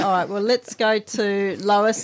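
normal talking voice

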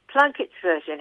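ordinary delivery

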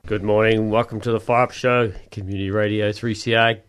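ordinary talk

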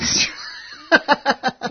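airy high self-mocking laugh; high breathy laugh. Real, not acted. Guest laughs at himself.

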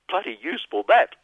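ordinary talk, nothing marked

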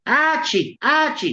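high, acted mother's scream; screamed name. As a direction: cry out for your lost child. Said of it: "she screamed".

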